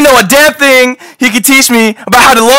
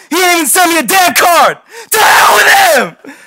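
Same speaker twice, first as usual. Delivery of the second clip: angry shout; 6 semitones higher than usual. Acted. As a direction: shout it out in bitter rage. Will's angry outburst at father.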